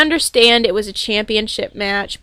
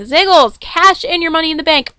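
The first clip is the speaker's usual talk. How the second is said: louder, higher call to the wrestler; 6 semitones higher, 4 dB louder than usual. Real, not acted. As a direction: urge him on like a fan at ringside. Calling out to wrestler.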